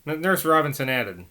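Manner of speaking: ordinary delivery, nothing marked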